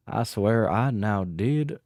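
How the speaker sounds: put-on mosey along in a big Texas cowboy accent. Streamer signing off to chat in a Texas accent. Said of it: "I'll do the Texas accent".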